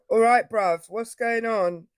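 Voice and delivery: London roadman accent, casual street greeting. Shown as a demonstration. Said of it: "Like that?".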